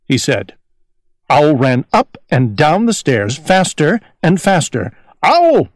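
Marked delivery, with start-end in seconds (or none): 5.2-5.7 high calling cry to himself; a small owl calling out; cried out. A narrator's reading. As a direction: call out his own name, high and loud. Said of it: "he cried".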